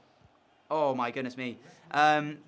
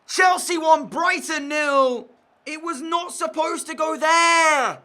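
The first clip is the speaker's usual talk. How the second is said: much higher, much louder, slow drawn-out complaint; angry disbelief; 11 semitones higher, 9 dB louder, slower than usual. Real, not acted. Gamer repeating the score to viewers, angry drawn-out yell. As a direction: spell out the score in outrage. After conceding the opener.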